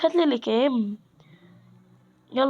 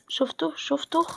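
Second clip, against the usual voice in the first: urgent whispered warning; whispering شفته twice, tense and hushed; 2 semitones higher, 4 dB quieter, slower than usual. Real, not acted. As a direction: hiss شفته، شفته under the breath so the enemy can't hear. Spotted an enemy in game.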